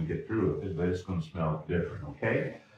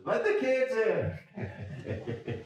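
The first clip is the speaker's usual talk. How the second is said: high, slow, laughing call of an eager wife; excited wife; much slower than usual. Retold or imitated. Pastor voicing his wife greeting the kids, high laughing call. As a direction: play the wife shoving past to let the kids in.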